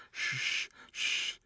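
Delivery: soft unvoiced shushing; hushing. Mildred hushes crying Clara, soft unvoiced shush. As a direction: hush her gently. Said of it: "said Mildred".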